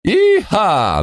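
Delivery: high squealing cowboy yell; cowboy whoop. Acted. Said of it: "на ковбойский манер взвизгнул".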